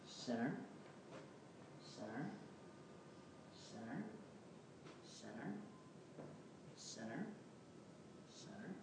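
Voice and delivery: soft, low repeated sinner, each with a small rise; God quietly naming each one a sinner; whispered sinner. Retold or imitated. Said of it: "He goes".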